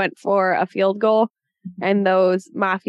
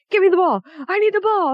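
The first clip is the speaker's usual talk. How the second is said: high, excited mock demand; 10 semitones higher, faster than usual. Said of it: "he's like".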